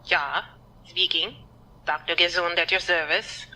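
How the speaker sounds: put-on a doctor faking a German accent to sound serious. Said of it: "I've been brushing up on my German accent".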